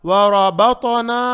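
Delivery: रबतना loud, slow, both ra and ta rounded; heavy ra and ta. Shown as a demonstration. Teacher models for students, heavy ra and ta. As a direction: thicken both letters in रबतना. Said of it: "ता को भी मोटा".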